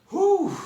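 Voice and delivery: high, loud exhaled whew; whew after laughing. Real, not acted. Storyteller recovering from laughing fit, loud high whew. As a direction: blow out a big relieved whoop.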